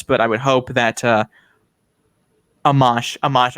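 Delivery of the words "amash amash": Amash said ah-MAHSH; corrected pronunciation. Shown as a demonstration. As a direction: stress the second syllable of Amash, with an open AH. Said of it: "I'm just used to people saying".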